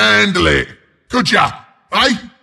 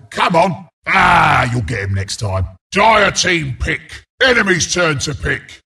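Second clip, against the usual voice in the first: ordinary talk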